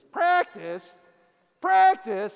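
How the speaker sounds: high, loud incredulous outburst; hammed-up imitation of an athlete's indignant rant; Allen Iverson rant. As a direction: blurt it in high-pitched, shouted disbelief. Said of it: "he has this famous rant where he says".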